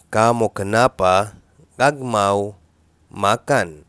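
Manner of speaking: "كامو كنابا غاغ ماو ماكان" slowed and split into chunks; slow broken repeat. Shown as a demonstration. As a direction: break it into pieces for the learner. (تكرار بطيء مقطّع لـ"كامو كنابا غاغ ماو ماكان")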